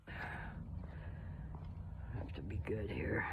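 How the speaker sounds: hushed so the dog stays calm; whisper. Real, not acted. Whispering as she creeps past the quiet dog.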